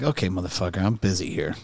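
low, quiet mock brush-off from a busy coach. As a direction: mutter it like a coach with no time. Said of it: "He's like".

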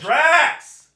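high excited shout of a name. Real, not acted. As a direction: call out the name, high and loud. Mustache reveal, shouted name.

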